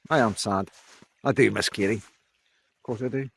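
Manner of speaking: quietly admitting he misses her; real sadness. Real, not acted. To viewers about Katie, softly sad and subdued. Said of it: "I'm sad".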